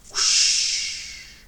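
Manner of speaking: breathy rushing steam noise; a sigh of steam; steam whoosh. A narrator's reading. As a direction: let off steam. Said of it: "let off steam with relief".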